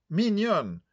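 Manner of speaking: mamie ravie, voix plus haute et plus forte; s'émerveiller du prénom avec un accent anglais; s'extasiant. A narrator's reading. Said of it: "s'extasia la petite mamie". (extase de vieille dame anglaise)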